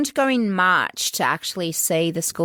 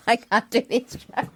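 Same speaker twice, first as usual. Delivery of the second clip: laughing. Real, not acted. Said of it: "I keep laughing at my own jokes".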